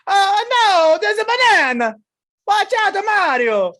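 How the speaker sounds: high, loud cartoon Italian alarm; terrible Italian accent. Gamer warning Mario of a banana, loud mock-Italian panic. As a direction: cry out in a hammed-up Italian accent. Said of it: "this terrible Italian accent".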